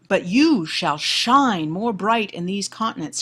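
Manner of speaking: slow, loud declaimed verse; poem recitation. Acted. Lecturer shows students the hero's line, declaimed recitation. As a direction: declaim the line with sweeping emphasis. Reads the sonnet aloud.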